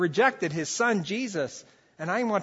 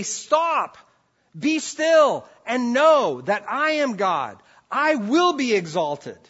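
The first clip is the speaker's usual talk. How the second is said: much higher and louder, slow and weighty; thundering divine command; 8 semitones higher, 9 dB louder, slower than usual. Acted. God commanding the world to be still, a loud slow proclamation. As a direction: proclaim it with full force. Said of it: "He's calling the world to say".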